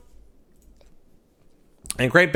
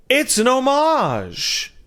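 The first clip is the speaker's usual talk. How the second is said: drawn-out exclamation; 11 semitones higher, much slower than usual. Acted.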